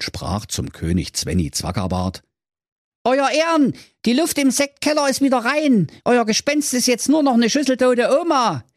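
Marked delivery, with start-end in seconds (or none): 3.0-8.6 high proud knight; high Manni voice. Acted. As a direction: report it like a smug hero.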